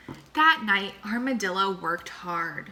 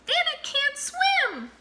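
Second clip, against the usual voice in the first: very high, astonished squeak; amazed gasp; slower than usual. A narrator's reading. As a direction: gasp in wonder at a talking stone. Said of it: "gasped Mouse".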